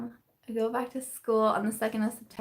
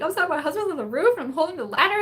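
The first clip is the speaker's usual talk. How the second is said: higher, louder, fast and rushed; flustered; 7 semitones higher, 4 dB louder, faster than usual. Retold or imitated. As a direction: rattle it off in a frantic hurry. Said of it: "I was like".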